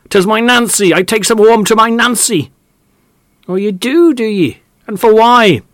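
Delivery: high, loud rustic argument; quarrelling farmers. Acted. Farmer grilling Mark Delore about the feathers, angry. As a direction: spar hotly over the chicken feathers.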